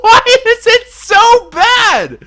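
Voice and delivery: high, slow laughing wail; laughing exasperation. Real, not acted. Gamer laughing at a hopeless cave taming, high and slow. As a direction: laugh it out in despair.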